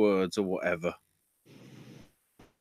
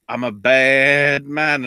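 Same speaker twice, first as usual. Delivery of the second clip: louder, slow, lightly voiced menacing boast; mock tough guy; 4 semitones higher, 9 dB louder, slower than usual. Retelling the wrestler's promo as a slow menacing boast. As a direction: growl it out like a mock villain. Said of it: "And he just goes".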